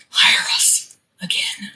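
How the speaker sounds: breathy whispered plea; whispering. Retold or imitated. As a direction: whisper it like a secret wish. Inner plea while performing on stage.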